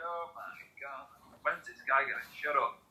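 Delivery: soft, weary aside; bored dad. Retold or imitated. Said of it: "My dad was like".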